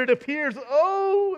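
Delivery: high cry, voice breaking; reporter breaking down. Pretend. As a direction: choke up mid-report.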